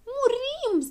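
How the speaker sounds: very high, drawn-out wail; high-pitched storybook cry of doom; panicked howl. A narrator's reading. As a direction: howl in hammed-up terror. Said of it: "se porni pe urlat Habar N-am".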